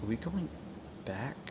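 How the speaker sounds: muttering to self. Real, not acted. Muttering while checking the route.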